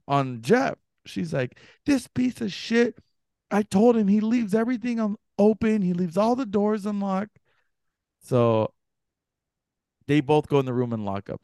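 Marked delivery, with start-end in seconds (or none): 1.9-7.4 high-pitched imitated rant; going off. Girlfriend rants at Jeff over unlocked doors, imitated scolding. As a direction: act out an exasperated girlfriend's tirade. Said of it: "She goes off on him".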